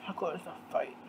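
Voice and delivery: very soft, low repeat; muttered aside. Real, not acted. Repeating the fight's cause to herself, muttered. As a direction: drop it to a low murmur.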